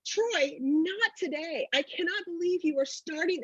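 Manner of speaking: high-pitched, slow exasperated scolding; retelling how she snapped at her fiancé on their wedding day; mock furious protest; retold fury. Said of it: "I'm furious".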